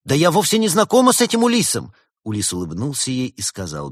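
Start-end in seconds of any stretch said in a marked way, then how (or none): 0.0-1.9 loud, high flustered denial; flustered protest. A narrator's reading. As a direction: protest in a panic. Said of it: "растерявшись от такого натиска".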